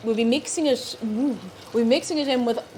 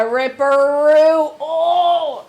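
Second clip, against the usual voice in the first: loud, slow, hammed-up; dramatic exclaim; 5 semitones higher, 10 dB louder, much slower than usual. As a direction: announce the villain with relish.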